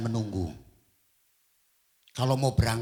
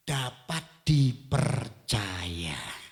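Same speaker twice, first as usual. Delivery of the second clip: slow emphasis; 2 semitones lower, much slower than usual. Acted. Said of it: "very slow emphasis".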